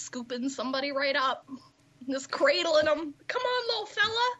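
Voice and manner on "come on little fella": much higher cartoon voice of an overly nice Canadian bouncer; squeaky cartoon Canadian, sweet as pie while tossing someone out; South Park cartoon voice. Imagining her friend as a gentle bouncer, in a high South Park voice. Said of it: "I just went straight South Park on that shit".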